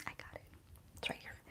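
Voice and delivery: barely audible whisper; very soft, under the breath; muttering to oneself. Real, not acted. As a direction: whisper it absently to yourself. Muttering to herself while searching.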